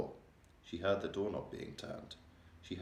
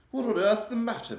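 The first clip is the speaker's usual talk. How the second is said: high, loud, regal voice, shaken; a distressed queen confiding in her maid; 14 semitones higher, 8 dB louder than usual. A narrator's reading. The Queen telling Mary of her nightmare, regal and upset.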